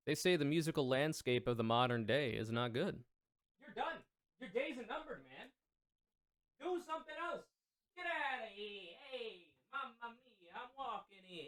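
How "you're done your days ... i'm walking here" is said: soft, high New York-ish get lost; mock tough-guy shooing. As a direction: shoo him off in a squeaky voice. Shooing someone in a put-on voice.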